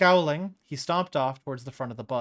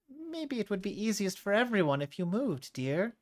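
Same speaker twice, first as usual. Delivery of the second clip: higher, gentle-condescending neighbor; older woman voice; 8 semitones higher than usual. Acted. The neighbor urging Salatra to move, higher and patronising. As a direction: talk down to her sweetly.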